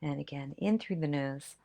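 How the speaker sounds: calm breathing instructions; guided breathing. Acted. Guides deep breaths together.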